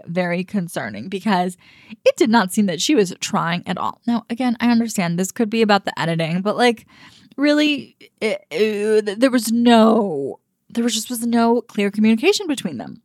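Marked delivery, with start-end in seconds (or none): none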